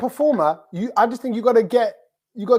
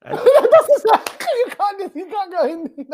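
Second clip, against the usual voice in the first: laughing; 11 semitones higher than usual. Real, not acted. Laughing at the attempt.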